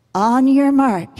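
ordinary delivery

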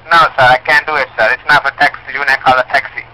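usual speaking voice

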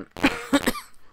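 sharp cough from a sore throat. Real, not acted. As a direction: cough mid-sentence. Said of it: "I'm still coughing".

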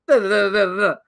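quick run of nonsense "da" syllables; imitated rapid switching. Podcaster shows cohost how the actor flips accents, rapid babble. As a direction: rattle off da-da-da to mimic switching. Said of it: "just go".

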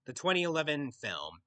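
ordinary talk

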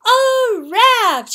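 high, loud cry of discovery; triumphant crowing. A narrator's reading. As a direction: crow it like a proud cockerel. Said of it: "he crowed triumphantly".

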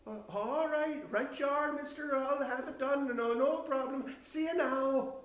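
high, loud, over-eager agreement; hasty placating. Retold or imitated. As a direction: agree loudly to get rid of him. Placating stranger to get rid of him.

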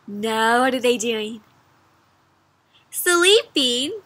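ordinary delivery, nothing marked

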